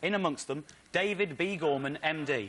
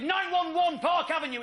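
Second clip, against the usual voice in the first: shouted address; 10 semitones higher than usual. Retold or imitated. To the taxi driver, an excited high shout. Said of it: "I shouted".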